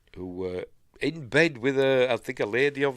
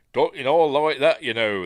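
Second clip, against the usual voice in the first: loud, higher mock southern English accent; southern England accent; 5 semitones higher, 9 dB louder than usual. Shown as a demonstration. Podcaster showing what a southern England accent sounds like, mock accent. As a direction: put on a southern England accent, louder and pitched up. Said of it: "not southern England accent, you know, going".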